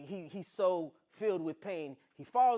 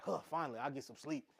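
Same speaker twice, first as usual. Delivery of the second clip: relieved sigh; 4 semitones lower, 8 dB quieter, faster than usual. Retold or imitated. Acts Eli relieved to sleep.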